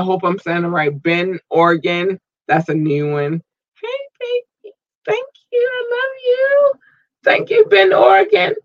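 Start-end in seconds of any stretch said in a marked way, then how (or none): 3.8-8.5 very high squeaky thank-you voice; playful squeal. Pretend. Podcast host thanking new listeners in Bend, playful high squeal. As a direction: gush affectionately in a tiny high-pitched voice.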